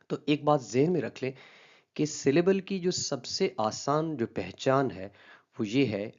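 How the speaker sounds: normal talking voice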